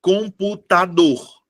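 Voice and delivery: slow, evenly spaced syllables of "Computador"; "Computador" drawn out in four separate beats; sounded out. Shown as a demonstration. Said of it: "qual dessas vogais é pronunciada com mais força".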